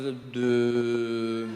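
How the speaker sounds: drawn-out low hesitation, stalled on every filler; mock French dithering, slow and low; hesitant French. Comedian showing French lacks confidence, hesitant drawl. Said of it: "there's not enough confidence in the language".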